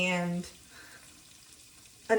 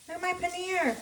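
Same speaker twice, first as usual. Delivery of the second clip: delighted; 12 semitones higher than usual. Real, not acted. Proud of browned paneer.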